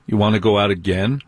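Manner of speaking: usual speaking voice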